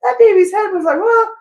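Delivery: high, loud put-on woman's voice; mother recalling birth. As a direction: play a mom breezily downplaying labor. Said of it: "she might be like".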